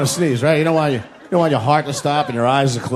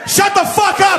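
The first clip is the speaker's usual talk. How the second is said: exasperated yell; slower than usual. Retold or imitated. Passenger to old lady who keeps sneezing, furious high yell. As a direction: snap and yell it at a high pitch.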